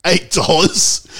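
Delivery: slow, high, stunned question; shocked disbelief. Retold or imitated. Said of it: "and I'm like".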